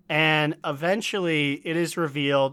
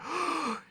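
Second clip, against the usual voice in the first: mock gasp; 12 semitones higher, 10 dB quieter than usual.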